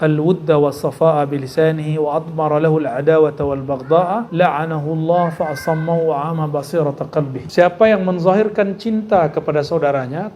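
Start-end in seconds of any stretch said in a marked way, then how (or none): 0.0-7.5 measured, chanted Arabic reading; intoned Arabic quotation; Arabic recitation. Acted. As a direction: recite the hadith in Arabic with care. Recites Arabic hadith text.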